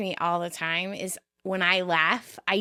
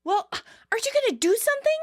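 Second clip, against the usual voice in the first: much higher, whining complaint, speeding up at the end; whiny Katie; 12 semitones higher than usual. Retold or imitated. Katie whining to Joey after being kicked, high and whiny. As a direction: whine at your boyfriend for help.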